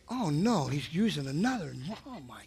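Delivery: half-hearted imitation of a fussy celebrity; Oprah Winfrey. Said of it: "Oprah Winfrey said".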